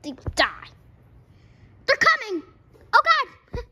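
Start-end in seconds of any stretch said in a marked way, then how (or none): 1.9-3.6 high alarmed yell; panicked shout. Pretend. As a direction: cry out the warning in alarm. Panic in game.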